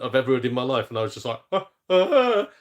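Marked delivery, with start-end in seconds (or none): 1.5-2.5 high, loud stammered "ah, ah"; mock baffled "ah". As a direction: imitate being lost for words, high and loud. Retold baffled reaction to bouncer.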